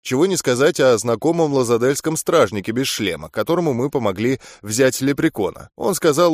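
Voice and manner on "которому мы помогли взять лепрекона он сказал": ordinary delivery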